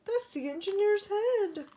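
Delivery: high, loud witch shriek of doom; witch crying out. Acted. A panicked witch on the runaway train cries out, high and loud. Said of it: "cried a witch".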